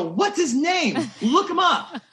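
high-pitched, eager demand; urgent excited demand. Retold or imitated. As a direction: retell it as a smitten, frantic order. Urgent demand to publicist in retold story.

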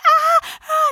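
high, loud mock toddler wail; imitating toddler crying. Mom imitating her crying toddler, mock wail. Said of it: "she's sitting in bed crying".

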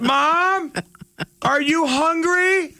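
over-enunciated yell to a hard-of-hearing parent; mock shouting at elderly. Imitating a care worker shouting at an old mom. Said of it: "talking like this".

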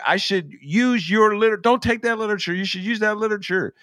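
high-pitched, worked-up mock tirade; imitated angry yelling. Man in red at the KKK across the street, imitated angry yelling. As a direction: imitate a man cursing someone out, pitched well up. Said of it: "starts yelling at him".

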